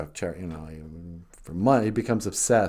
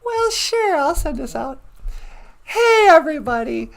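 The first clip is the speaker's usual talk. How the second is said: bright sign-off; 6 dB louder than usual. Real, not acted. Redoing the sign-off.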